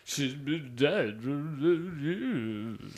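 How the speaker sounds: put-on slow drawling old cowboy voice; lightly put-on old cowboy, very slow. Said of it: "He definitely does".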